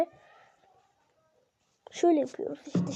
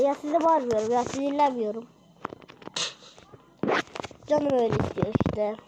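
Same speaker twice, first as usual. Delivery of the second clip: ordinary delivery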